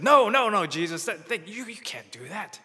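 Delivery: high, loud protest dropping to a whisper; protesting disciples. Acted. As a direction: play the disciples' alarmed denial. Preacher voices protesting disciples.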